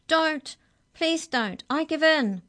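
high, loud panicked begging; gasped plea. A narrator's reading. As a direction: beg frantically and surrender. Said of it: "when Maury gasped".

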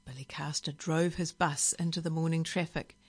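usual speaking voice

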